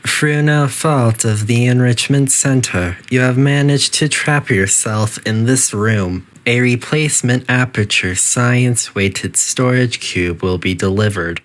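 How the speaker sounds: calm, even automated announcement voice; a lightly voiced facility intercom; facility announcer. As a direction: read it like a polite recorded PA system.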